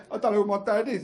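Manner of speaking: a touch of put-on tearfulness; mock tearful child. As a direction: imitate a kid welling up. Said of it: "he got all tearful".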